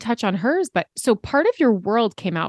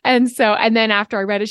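ordinary talk, nothing marked

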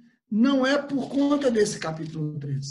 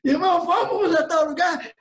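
raised, louder call to a fellow believer; rally them with an eager question; rousing invitation; 6 semitones higher, 5 dB louder than usual. Acted. Preacher acting out inviting a brother, rousing call.

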